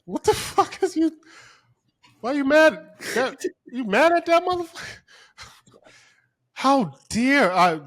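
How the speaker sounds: very high, loud comic disbelief; loud, high-pitched "are you crazy?" reaction; incredulous outrage. Acted. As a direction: squeal in mock bafflement at their anger. Acts out his baffled reaction.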